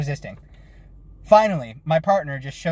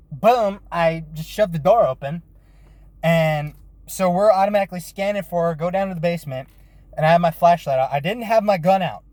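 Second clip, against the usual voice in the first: ordinary delivery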